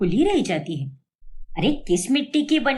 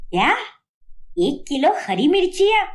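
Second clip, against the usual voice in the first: shocked disbelief; 5 semitones higher than usual. Acted. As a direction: repeat it in utter disbelief. Shocked mother-in-law hears of chillies. (हैरानी से चौंककर)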